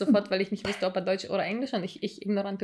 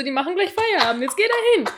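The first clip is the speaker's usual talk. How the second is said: mock frantic urging; 8 semitones higher, 5 dB louder than usual. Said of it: "Anni rastet schon wieder aus".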